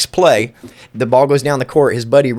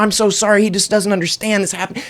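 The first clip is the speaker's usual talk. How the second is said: mock reassurance; 6 semitones higher than usual. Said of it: "I was like".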